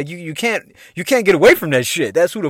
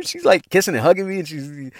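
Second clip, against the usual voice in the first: ordinary talk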